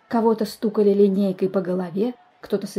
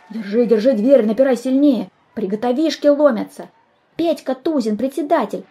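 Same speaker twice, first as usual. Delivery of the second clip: loud, raised alarm; urgent calling; 4 semitones higher, 6 dB louder than usual. Acted. Pupils at the door call the chairman, urgent shouting. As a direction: shout urgent orders over a scuffle.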